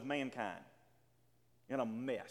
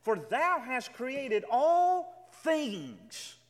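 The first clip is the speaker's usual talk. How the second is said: "all" stretched and stressed, very slow and louder; slow emphatic reading; 6 semitones higher, 5 dB louder, much slower than usual. Shown as a demonstration. Preacher making the congregation grasp God's power: very slow, louder emphasis on "all". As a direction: lean on "all", drawing every word out. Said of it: "Let this sink in for a moment".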